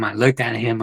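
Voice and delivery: ordinary talk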